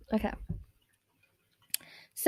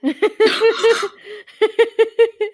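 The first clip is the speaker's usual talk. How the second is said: high bursting laugh; loud laugh; 9 semitones higher, 3 dB louder than usual. Real, not acted. Laughing at own joke.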